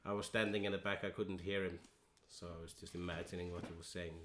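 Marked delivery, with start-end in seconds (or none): none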